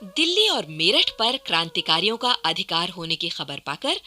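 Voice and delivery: ordinary delivery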